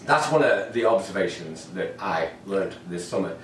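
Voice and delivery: ordinary talk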